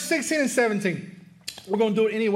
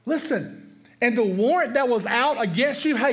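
normal talking voice

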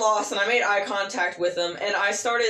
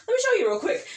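much higher, eager offer; bright helper voice; 14 semitones higher than usual. Retold or imitated. Retelling helping a customer, bright higher offer. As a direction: pitch it up like a cheerful clerk. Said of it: "and I was like".

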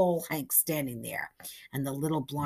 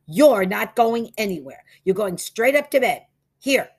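loud, raised-pitch orders; stern father; 7 semitones higher, 10 dB louder than usual. A narrator's reading. Father sending his son straight to bed, stern. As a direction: lay down the law.

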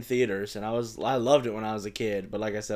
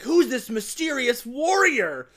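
higher, slow, mock-heroic line; slow mock-epic hero voice, pitched higher; hammed-up Leonardo; 13 semitones higher, 9 dB louder, slower than usual. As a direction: ham up a grand hero asking who this is. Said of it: "He's like".

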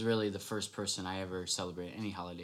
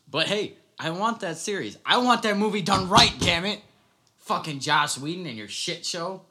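loud, high fan outburst; excited shouting; 7 semitones higher, 11 dB louder than usual. Real, not acted. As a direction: demand it at the top of your voice. Passionate demand for Snyder Cut.

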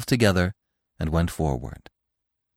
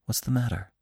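soft, slightly raised concerned murmur; solicitous whisper; 5 semitones higher, 6 dB quieter, faster than usual. A narrator's reading. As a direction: ask softly, with gentle concern. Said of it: "he whispered solicitously, while his voice quavered".

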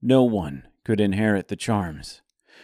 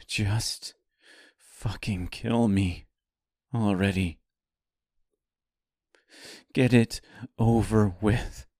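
holding back tears. Acted. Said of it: "Her voice cracked as she fought the urge to cry".

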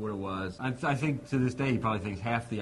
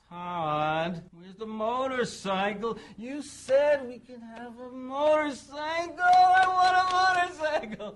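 put-on higher and higher, louder, slow whiny child pleading; annoying whining kid; 7 dB louder, much slower than usual. [name] whining at Todd for a motorcycle, whiny kid voice. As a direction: whine like a spoiled four-year-old. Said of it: "the really annoying, whining kid".

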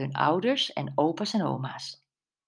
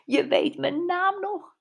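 very high-pitched, excited squeal of words; delighted exclamation; 13 semitones higher than usual. A narrator's reading. Brenda reacts to her detective nickname, excited exclamation. Friend delighted at being named.

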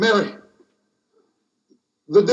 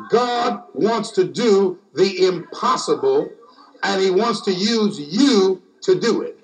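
slower emphatic repeat. Shown as a demonstration. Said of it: "Let me say it again".

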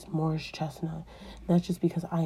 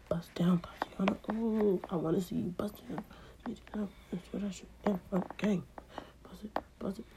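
hushed playful aside; soft whispered riff; 10 dB quieter than usual. Real, not acted. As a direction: murmur it to yourself. Whispered aside singing along.

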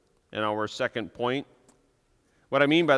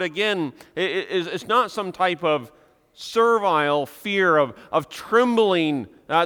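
louder, higher preaching with slowed stressed words; fervent preaching; 5 semitones higher, 5 dB louder than usual. Acted. Pastor urging his congregation, louder fervent preaching. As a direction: preach it out with passion.

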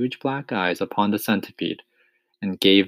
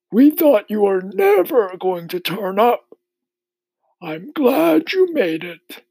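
put-on high, loud old grasshopper voice; 6 dB louder than usual. A narrator's reading. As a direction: call out as a storybook old insect.

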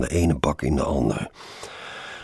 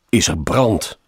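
higher, louder exasperated call; 5 semitones higher, 6 dB louder than usual. A narrator's reading. Passenger roused by endless ringing, calling down in exasperation. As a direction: shout the question down from a window.

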